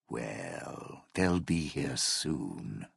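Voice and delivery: low, soft, drawn-out threat; quiet menace. Acted. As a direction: let the threat sink in slowly and quietly. Veiled threat about security coming.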